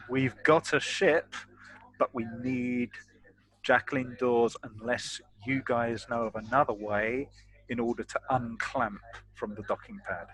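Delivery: ordinary talk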